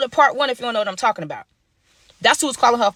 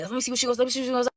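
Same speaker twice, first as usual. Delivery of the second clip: much softer eager murmur; hushed aside; 2 semitones higher, 8 dB quieter, much faster than usual. Retold or imitated. Narrator pressing the phone to his ear, hushed and eager. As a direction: keep it down while eavesdropping. Listening in on the call.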